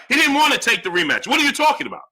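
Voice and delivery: high, heated argumentative voice; exasperated insistence. Real, not acted. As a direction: hammer the point at a stubborn viewer. Arguing back at a viewer.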